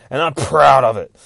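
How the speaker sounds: loud, defiant burst; acted-out proud shout; retold screaming. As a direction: belt out the boast as the character. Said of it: "she's fucking screaming at this motherfucker".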